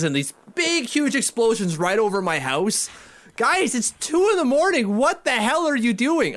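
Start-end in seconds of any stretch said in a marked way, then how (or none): 3.4-6.4 high-pitched exasperated holler; re-enacted high, fed-up outburst; exasperated yelling at neighbours. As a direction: holler at noisy revellers in the night. Acting out late-night yell at fireworks people.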